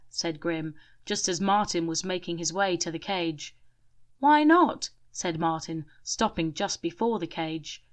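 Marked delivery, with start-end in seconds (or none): 4.2-4.9 high, loud protest; sharp questioning protest; surprised. A narrator's reading. Martin challenging Grim, high and loud.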